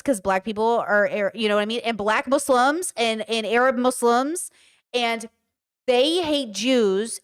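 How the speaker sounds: usual speaking voice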